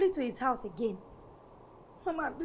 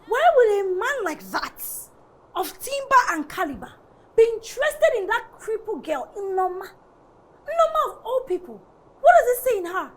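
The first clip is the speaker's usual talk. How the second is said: indignant rant with high, loud outbursts; angry; 3 semitones higher, 5 dB louder than usual. Acted. Daughter venting to Mama about Desmond, angry. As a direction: complain in outraged disbelief, pitch jumping up. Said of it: "I'm so angry".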